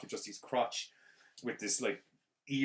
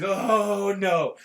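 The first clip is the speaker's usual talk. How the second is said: loud, high mock wail; exaggerated cowardly howling; whiny wailing; 9 semitones higher, 12 dB louder, much slower than usual. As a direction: bawl pathetically, hammed up. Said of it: "like a little bitch".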